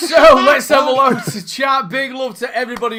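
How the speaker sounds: big loud shout-out to the chat; loud host call. Real, not acted. Host shouting thanks to the chat, loud. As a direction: belt it out to the viewers.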